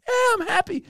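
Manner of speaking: squeaky-high forced delight; put-on excitement. Comedian plays along with booker, high forced cheer. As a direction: overplay the happiness.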